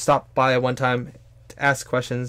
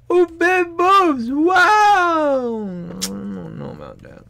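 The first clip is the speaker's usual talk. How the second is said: mock shocked shout; 6 dB louder, much slower than usual. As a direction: gasp and wail, then trail off doubtful. Mocking reaction to big boobs.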